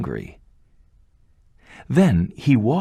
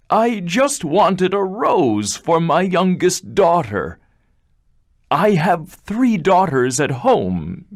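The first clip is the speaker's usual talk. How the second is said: pleading; 9 semitones higher, 3 dB louder than usual. Acted. Father pleading with the beast.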